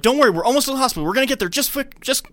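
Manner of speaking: rushed panicked father reassuring; mock frantic reassurance. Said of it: "Peter's like".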